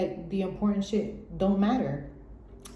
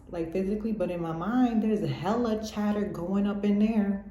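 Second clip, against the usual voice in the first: ordinary delivery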